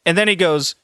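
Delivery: ordinary talk, nothing marked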